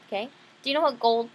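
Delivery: ordinary delivery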